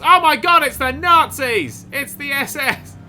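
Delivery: high, loud mock alarm; excited shock. Real, not acted. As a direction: shriek it in mock horror. Mock alarm at rival cars.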